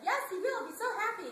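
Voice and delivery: put-on high, loud eager kid reply; nephew's eager reply. Retelling the nephew agreeing, high and eager. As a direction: cheer it back like an eager nephew. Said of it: "He's like".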